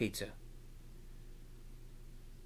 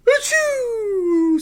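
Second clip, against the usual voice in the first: very loud, very high hammed-up sneeze; huge fake sneeze; 13 dB louder than usual. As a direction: blast out a cartoon achoo. Acting the cow's sneeze.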